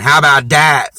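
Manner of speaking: high, loud mock taunt; mocking sassy girl. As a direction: mimic her trashy challenge. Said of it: "act jealous and get vocal".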